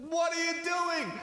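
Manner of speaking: high, strained mock shout; exasperated outburst. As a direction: protest at a partner's betrayal in a high yell. Exasperated at partner starting conversation.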